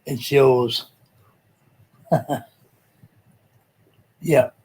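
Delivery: ordinary talk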